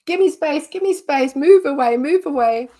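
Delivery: high, loud urgent plea; dog begging for space. Pretend. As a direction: voice the anxious dog pushing others away. Dog voiced asking for space.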